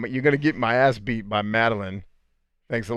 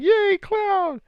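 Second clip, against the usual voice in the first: very high, loud fake hooray; hammed-up falsetto cheering; mock cheer; 5 dB louder than usual. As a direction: squeal like an over-eager fan club. Imitates everyone cheering Cloud.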